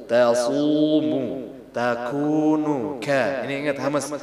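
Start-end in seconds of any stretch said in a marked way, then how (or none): 0.1-3.0 tasumu and takunu stretched slowly with a heavy su; slow Quranic syllable drill; slow drawn-out syllables. Shown as a demonstration. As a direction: chant each syllable slowly. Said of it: "tajwid reading demo".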